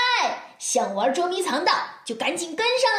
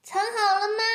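calling out; 6 semitones higher than usual. Acted. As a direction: call out to hidden friends. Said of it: "异口同声地喊道".

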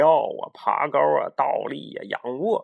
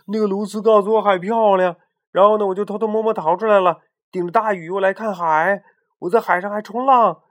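higher, louder, fast eager explaining; pleading defense; 7 semitones higher, 6 dB louder than usual. Acted. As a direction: talk your way out of the pot. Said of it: "竭力地为自己辩护".

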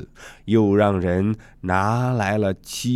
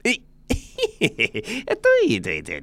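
gleeful giggle, then repeated agreement; delighted Sun Wukong; 9 semitones higher than usual. Acted. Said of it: "喜上眉梢".

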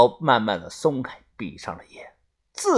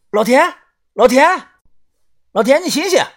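urgent calling; 9 semitones higher, 4 dB louder than usual. Acted. Shaking dying man awake.

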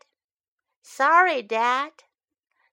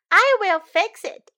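put-on eager child; 9 semitones higher, 8 dB louder than usual. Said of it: "I say".

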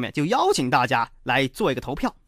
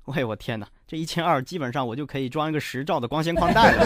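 usual speaking voice

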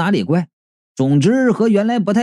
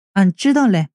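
coy rustic reply. A narrator's reading.

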